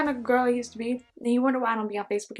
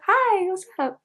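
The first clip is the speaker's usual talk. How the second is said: cheery; 9 semitones higher, slower than usual. Retold or imitated. Cheery phone greeting.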